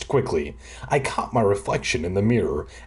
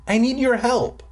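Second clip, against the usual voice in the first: mock shout; 11 semitones higher than usual. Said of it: "he shouted from his bedroom".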